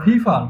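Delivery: ফিফার with aspirated p sounds; British-style pronunciation. Shown as a demonstration. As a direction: say Pipar as ফিফার, puffing each p. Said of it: "পিপারটা হবে ফিফার".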